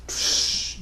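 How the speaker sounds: long, soft shush; urgent parental shushing; acted hushing. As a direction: hush the child with a drawn-out "shh". Father hushes his defiant son.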